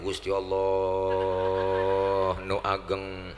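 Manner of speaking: slow, low mock Sundanese takbir. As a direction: drag it out like a Sundanese villager. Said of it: "Orang Sunda takbir". (takbir logat Sunda yang diseret pelan)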